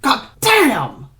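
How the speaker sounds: loud, high pained outburst; blurted out. Acted. Ignatius struck in the jaw, loud high pained curse. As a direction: spit it out after the blow. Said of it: "Ignatius blurted out".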